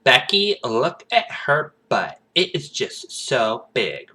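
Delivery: put-on high, loud, slow valley girl gush; drawn-out catty valley girl; mock Beverly Hills girl gossiping to Becky. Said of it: "California preppy Beverly Hills voice".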